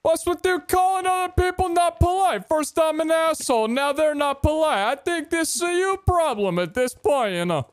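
very high, hammed-up New Yorker rant; mock New York accent. Gamer telling a friend it's their problem, mock New York accent. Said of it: "as a New Yorker, I feel offended".

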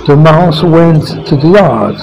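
usual speaking voice

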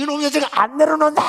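high, loud, fast demand; angry struggling mother. Retold or imitated. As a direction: kick and yell to be put down. (내려달라고 소리치는 어머니)

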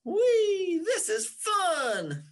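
high, loud, gleeful cry of delight; excited whee. Acted. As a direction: shout it like a kid on a slide. Raindrop rushing down the roof.

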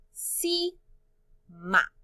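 "cima" split into ci, ma; syllable by syllable. Shown as a demonstration. Teacher introduces a word to sort, "cima" in separate syllables. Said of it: "Cuántas sílabas en palabra cima".

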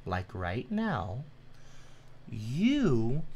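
mocking a viewer, talking very slowly and softly. Real, not acted. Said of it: "let me say this very slowly for you".

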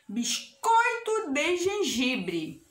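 slow, drawn-out recipe headings; slow read-aloud. Shown as a demonstration. Teacher reading a recipe to children, slowly and deliberately.

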